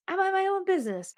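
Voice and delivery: quick, high self-reminder; high-pitched repeat. Retold or imitated. Podcaster telling herself to stay out, high quick voice. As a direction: say it fast, way up in pitch.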